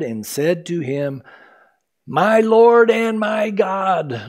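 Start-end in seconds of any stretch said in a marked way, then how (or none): 2.1-4.3 awed exclamation. Acted. Thomas overwhelmed before risen Jesus.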